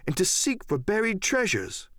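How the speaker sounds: ordinary delivery, nothing marked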